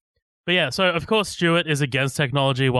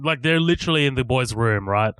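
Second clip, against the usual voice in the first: normal talking voice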